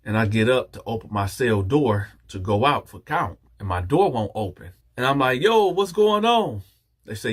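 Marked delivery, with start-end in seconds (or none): 5.4-6.6 high, drawn-out call; calling out in alarm. Retold or imitated. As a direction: holler out through a locked door. Calls out from locked cell.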